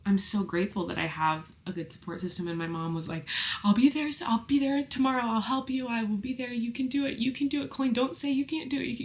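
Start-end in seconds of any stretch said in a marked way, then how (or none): 3.6-9.0 higher-pitched, insistent encouragement; sweet reassuring mom. Retold or imitated. Mom comforting her overwhelmed daughter by phone, sweetly reassuring. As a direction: imitate a mom urgently reassuring her child. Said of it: "She was so sweet".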